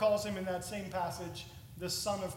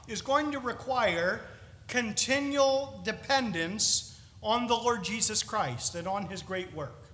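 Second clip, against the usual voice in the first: normal talking voice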